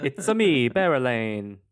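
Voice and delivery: put-on playful over-the-top Italian accent; jokey Italian accent. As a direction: boom it out like a cartoon Italian plumber.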